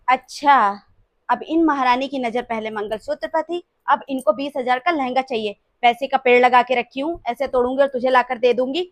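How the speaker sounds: loud mocking tirade; sarcastic scolding. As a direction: sneer and scold her greed. Mother-in-law mocks and scolds bahu. (ताना मारती गुस्से वाली डाँट)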